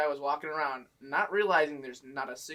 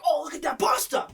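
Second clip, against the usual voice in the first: mock shopper excitement; 14 semitones higher, 6 dB louder than usual.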